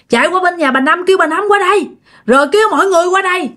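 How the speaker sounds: high-pitched, urgent reenacted orders; retold shouting. Father sending his child to fetch neighbours, retold urgent shout. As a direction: act out a father calling for help. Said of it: "mới la làng lên nói".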